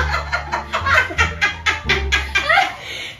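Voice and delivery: long giggle. Real, not acted. Laughing during challenge.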